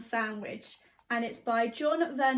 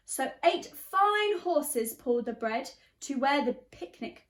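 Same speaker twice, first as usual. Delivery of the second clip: lively storybook reading in rhyme; teacher reading a picture book, animated; reading to children; 2 semitones higher, slower than usual. A narrator's reading. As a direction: bounce the verses for a class. Reads picture book aloud.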